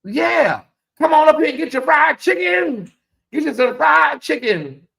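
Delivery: high shouted singsong sales pitch; mock Mary shouting. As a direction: belt it like a singer hyping fried chicken. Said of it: "Mary said".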